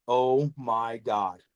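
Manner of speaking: slow, low retold disbelief; stunned reaction. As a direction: draw it out slowly, low and stunned. Retold reaction to hitting four clowns.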